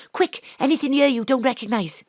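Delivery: normal talking voice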